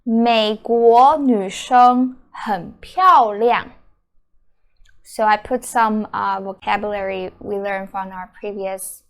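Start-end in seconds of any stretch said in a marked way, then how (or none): 0.0-3.7 美国女生很漂亮 said slowly and clearly; slow demo sentence. Shown as a demonstration. Teacher slowly reading the example sentence. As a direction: model the sentence at learner pace. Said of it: "The first sentence is".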